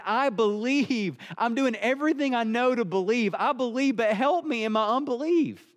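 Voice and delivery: high-pitched, earnest acted plea; pleading father. Father pleads with Jesus for help in his unbelief, pleading. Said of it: "here this father is saying".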